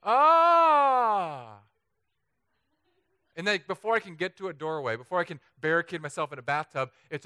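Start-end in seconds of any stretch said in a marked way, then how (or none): 0.0-1.6 sudden loud high startled yell; jolted awake by a quake, loud high yell; earthquake scream. Acted. Acting out waking in an earthquake, sudden loud high yell.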